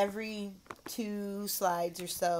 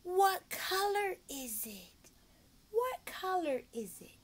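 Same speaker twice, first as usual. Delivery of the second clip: high, slow "What color is it?"; teacher sing-song; slow question; 9 semitones higher, slower than usual. Shown as a demonstration. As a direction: draw the question out for a child. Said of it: "bust up the colors".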